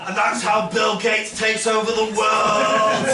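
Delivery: high, whiny-loud online crank voice; mock a conspiracy theorist's alarmed rant. Conspiracy theorists going online about the vaccine, mock ranting. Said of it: "hear the conspiracy theorists go online go".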